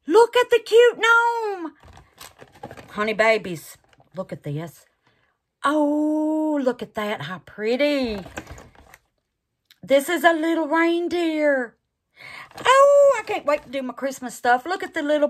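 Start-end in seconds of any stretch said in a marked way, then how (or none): 0.1-1.7 high and loud, cooing; squealing over the cute gnome; cooing delight. Real, not acted. 12.6-13.2 high loud squeal of joy; thrilled high cry; delighted squeal. Real, not acted. Squeal at Christmas molds.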